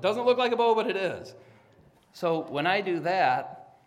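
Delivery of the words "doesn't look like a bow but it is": excited. Real, not acted. Self-correcting joke.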